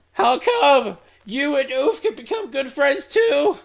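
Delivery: put-on high, slow girlish character voice; high-pitched friendly schoolgirl; cheery club girl.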